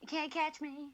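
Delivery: high, playful sing-song; mock taunt. Mocking the greased up naked guy, sing-song. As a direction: tease like a runaway.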